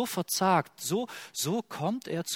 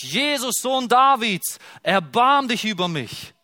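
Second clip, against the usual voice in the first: laut und hoch hinausgerufen; verzweifelter Schrei; 7 semitones higher, 7 dB louder than usual. Retold or imitated. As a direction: ruf es quer über die ganze Stadt. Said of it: "Der schreit". (der blinde Bartimäus schreit nach Jesus, laut und hoch)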